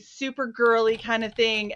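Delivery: normal talking voice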